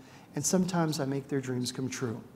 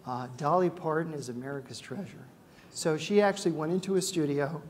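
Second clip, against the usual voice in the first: normal talking voice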